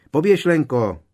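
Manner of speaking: loud, high shout to Lenka; urgent running shout. Acted. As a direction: yell it while running past. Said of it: "křikl Dean".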